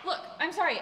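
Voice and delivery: ordinary talk, nothing marked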